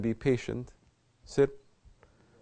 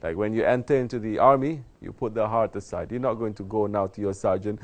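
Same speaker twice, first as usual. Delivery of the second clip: normal talking voice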